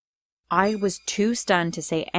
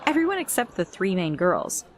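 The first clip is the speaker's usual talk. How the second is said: ordinary delivery, nothing marked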